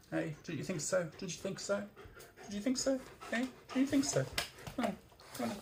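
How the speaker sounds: high, soft sing-song questioning; talking to a dog. Real, not acted. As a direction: coo it high to your dog.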